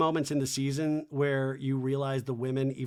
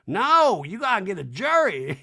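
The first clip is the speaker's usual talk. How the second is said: high, loud mock Erica; 5 semitones higher, 7 dB louder than usual. Recapping Erica demanding a jury in a loud mock voice. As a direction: ham up Erica's outrage.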